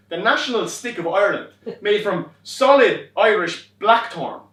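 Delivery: loud, hammed-up stage Irish brogue; begorra-spouting Irish caricature to please a driver. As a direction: lay on the paddywhack Irish. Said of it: "talk to him on his level".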